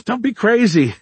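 high-pitched nervous laughing protest; flustered denial. Acted. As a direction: laugh it off too eagerly.

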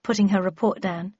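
plain reading voice

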